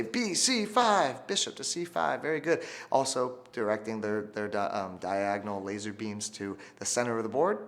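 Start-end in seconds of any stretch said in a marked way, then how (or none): 0.0-2.2 high, slow call-and-response prompt; teacher modeling a chant for students; prompt for class. Shown as a demonstration. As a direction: sing it out high for the kids to echo. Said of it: "Everyone say".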